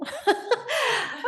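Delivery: bright burst of real laughter, high; short amused laugh. Real, not acted. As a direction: laugh it off at the missing video. Laughs at missing video.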